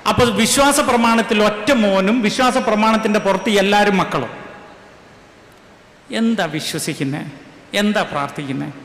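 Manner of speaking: normal talking voice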